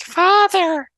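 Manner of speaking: imitating a creepy child, high-pitched; creepy little voice. Dad imitating his daughter calling him, creepy little voice. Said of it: "did this creepy little voice".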